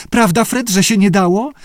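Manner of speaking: high pleading squeak. A narrator's reading. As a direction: squeak it, appealing for backup. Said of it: "pisnęła Judy". (piskliwa, wysoka prośba o potwierdzenie)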